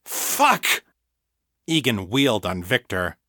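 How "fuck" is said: high, shocked outburst; shocked curse. A narrator's reading. Egan seeing Mother killed, high shocked curse. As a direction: curse in horror.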